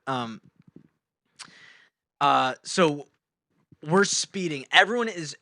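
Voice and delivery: ordinary delivery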